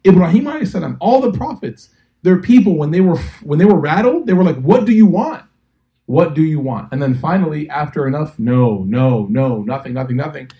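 high and loud sermon voice; preacher shouting the story to the crowd; impassioned preaching. Acted. Preacher at full force.

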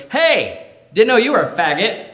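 a passing guy's high, jeering taunt; mocking sneer. Acquaintance passing by taunts the smoker, high mocking jeer. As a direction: sneer it out at him. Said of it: "and he goes".

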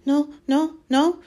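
high, loud elf squeak; squeaking house-elf. A narrator's reading. Elf refusing to let Harry return, squeaking. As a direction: squeak each refusal in alarm. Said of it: "squeaked Dobby".

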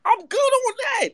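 squeaky fed-up refusal to his co-host; high exasperated protest. Real, not acted. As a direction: push the voice way up in disbelief. Refusing to hear co-host's point.

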